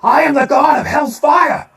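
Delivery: high, loud demonic proclamation; booming shout. Acted. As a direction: bellow it like a rock god. Booming into the mic after fix.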